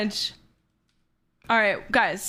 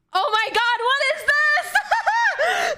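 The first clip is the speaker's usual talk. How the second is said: very high, drawn-out squeal breaking into laughter; laughing, stretched-out high exclamation; amazed squeal; 14 semitones higher, much slower than usual. Real, not acted. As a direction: shriek with delighted surprise. Amazed at a birthday artwork.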